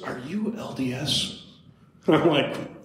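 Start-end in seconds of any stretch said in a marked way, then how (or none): 0.0-1.4 softer, slowed-down imitation of a stranger asking; hushed, slow retold question; tentative question. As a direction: ask it carefully, under the voice.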